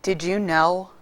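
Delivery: heavy-hearted did you know; sad. Shown as a demonstration. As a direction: ask it quietly with sorrow.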